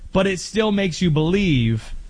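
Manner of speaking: ordinary talk, nothing marked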